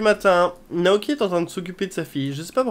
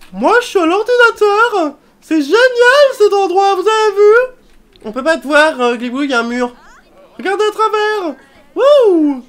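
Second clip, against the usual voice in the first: voiced Sim; 13 semitones higher, 8 dB louder than usual. Sim at the computer shouts to her friends.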